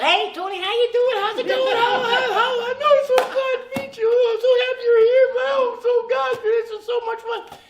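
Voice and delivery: high, loud gushing mock squeal; hammed-up bubbly greeting, piled on breathlessly fast. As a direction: gush over a newcomer at full volume. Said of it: "she's just, like, bubbly shit".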